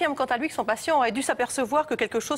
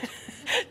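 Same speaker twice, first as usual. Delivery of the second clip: airy suppressed giggle; stifled breathy laugh; 6 dB quieter than usual. Real, not acted. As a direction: snort of held-back laughter. Stifled laugh before the name.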